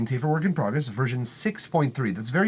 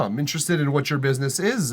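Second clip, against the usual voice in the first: ordinary talk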